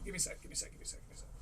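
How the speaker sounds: breathy whisper, no voice. Real, not acted. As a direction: whisper it quickly to yourself. Stalling while changing wardrobe.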